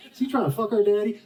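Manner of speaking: high, disbelieving mock voice of grown sons; a mocking high voice asking if he is after their father; incredulous sons. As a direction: squeak it out like scandalized sons. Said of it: "they're like".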